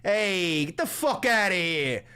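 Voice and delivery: loud, high New York Italian tough-guy shout; New York Italian accent. Shown as a demonstration. Streamer showing chat a New York Italian accent, loud and brash. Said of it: "you've heard New York Italian accent".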